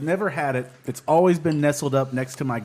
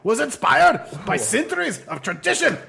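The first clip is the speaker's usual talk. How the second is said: put-on Scottish accent; 8 semitones higher, 5 dB louder than usual. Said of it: "Now I'm just doing Scottish".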